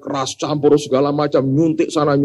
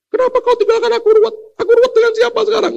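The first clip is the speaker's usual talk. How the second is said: much higher, whiny mock-grieving voice; careless mourner; 4 dB louder, faster than usual. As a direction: squeal out a careless friend's fake sorrow. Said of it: "Ngomongnya seenaknya".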